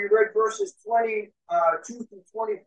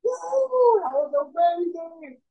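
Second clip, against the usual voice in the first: cheering crowd; 7 semitones higher than usual. Acted. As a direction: imitate an amazed crowd cheering. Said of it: "everybody's cheering".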